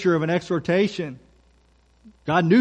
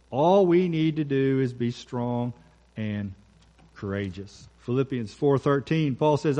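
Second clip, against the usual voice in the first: ordinary delivery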